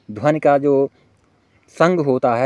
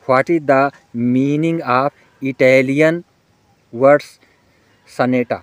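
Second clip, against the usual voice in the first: very slow, word by word; deliberate dictating read; slow dictation; much slower than usual. Shown as a demonstration. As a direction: spell the question out slowly for students to copy. Dictating exam question to students.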